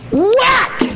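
high loud shouted whack; whack sound. Retold or imitated. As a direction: shout the bat's impact. Sound of bat hitting.

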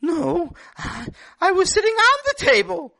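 put-on child voice explaining himself; little boy. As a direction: play a young boy insisting he did nothing wrong. Boy reveals the loophole.

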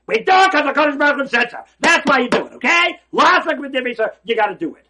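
loud, high preaching at full force; rising sermon fervour; fiery preaching. Acted. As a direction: drive it home to the congregation. Preacher insists on obedience.

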